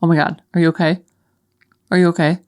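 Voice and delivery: usual speaking voice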